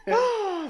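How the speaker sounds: high, drawn-out swoon; gasp, then a hammed-up high sigh; mock swooning "oh". Host joking about the cashier's flirting, mock swoon.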